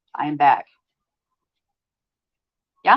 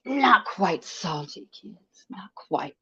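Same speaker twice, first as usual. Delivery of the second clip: slow whisper; much slower than usual. Real, not acted. Streamer teasing the kids in chat, whispering slowly. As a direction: drop to a slow, breathy hush.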